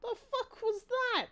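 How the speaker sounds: high, slow stunned question; baffled disbelief. Real, not acted. Streamer asks what just happened, stunned. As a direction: drag it out in disbelief.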